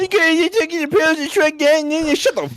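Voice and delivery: high-pitched jeering imitation; mocking mimicry. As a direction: throw the words back in a high mocking whine. Mimics the squirrel knight's complaint.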